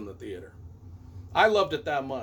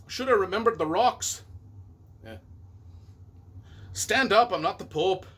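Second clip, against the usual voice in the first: attempted Scottish accent; 3 semitones higher than usual. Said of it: "trying to do a Scottish accent".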